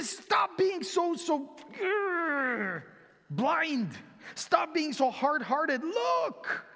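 high, slow insistent plea; pleading preaching. Acted. Preacher pleading with hard hearts, high insistent call. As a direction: urge them to open their eyes.

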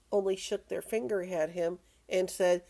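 ordinary delivery, nothing marked

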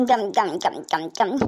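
soft, low mock munching; chewing noises. Chewing while being fed.

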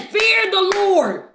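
ordinary delivery

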